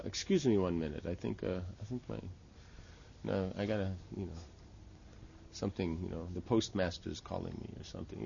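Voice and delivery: acting an awkward guest slipping away; mock polite excuse. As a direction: trail quietly through a made-up excuse. Acted guest making excuse to leave.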